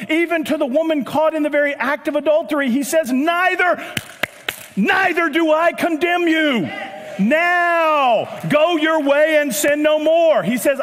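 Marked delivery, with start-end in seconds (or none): 3.1-10.4 raised, higher emphatic declaration; preached proclamation. Acted. Jesus to the woman caught in adultery, forcefully proclaimed.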